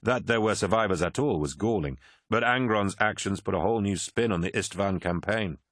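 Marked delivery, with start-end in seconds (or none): none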